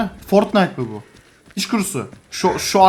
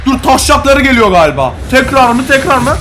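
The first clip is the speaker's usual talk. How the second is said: loud, higher excited yelling; excited shout; 6 semitones higher, 5 dB louder than usual. Real, not acted. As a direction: shout it out in the heat of battle. Excited shouting during firefight.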